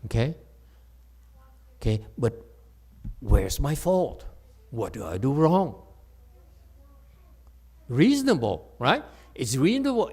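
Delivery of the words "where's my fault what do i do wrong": ordinary talk, nothing marked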